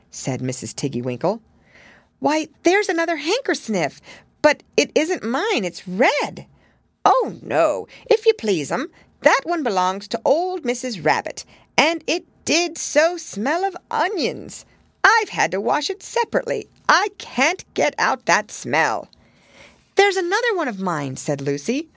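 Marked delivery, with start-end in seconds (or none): 2.2-6.5 put-on little girl. A narrator's reading. Lucy voiced in story. 7.0-19.1 high, bobbing little washerwoman's voice; little hedgehog laundress curtsying to Lucy. A narrator's reading. 20.0-21.2 put-on little girl. A narrator's reading. Lucy voiced.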